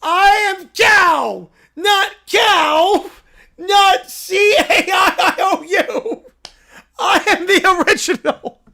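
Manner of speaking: put-on high, loud, slow child yell; shouting bratty kid. Caillou insisting he is the original, slow furious yell.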